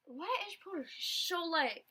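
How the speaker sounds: ordinary delivery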